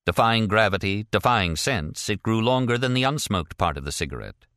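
usual speaking voice

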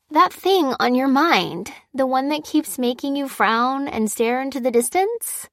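high, lilting voice of a nosy friend; probing friend. Acted. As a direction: coax it out of her with teasing emphasis. Voices Fliss probing Lucy.

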